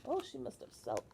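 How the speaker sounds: ordinary delivery, nothing marked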